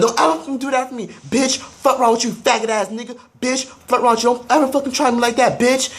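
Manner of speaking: high mocking voice, sister raging; shrill it up high, making fun of her rage; mock sister yelling. Mimicking the sister cursing at her over the mayo, high.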